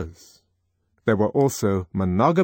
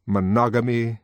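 ordinary delivery

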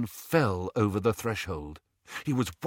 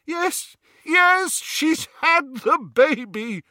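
high, loud, drawn-out elated shout; 5 dB louder, slower than usual. A narrator's reading. New father confirming the birth to friends, shouting. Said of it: "shouted Lupin".

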